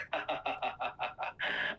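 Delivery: staccato imitated villain laugh; mock evil cackle. Possessing villain gloats, retold.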